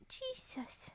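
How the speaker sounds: high, breathy whispered Jesus; whispered disbelief. Real, not acted. Shock at story.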